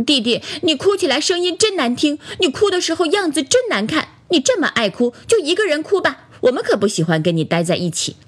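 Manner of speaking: high scolding, dropping and speeding up at the end; annoyed toy friends. A narrator's reading. Toys reject the crybaby doll, mildly angry scolding. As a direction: scold childishly with a touch of anger. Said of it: "朋友们都生气了".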